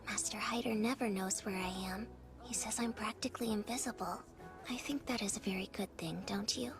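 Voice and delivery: breathy little whisper. Acted. Child whispering in game.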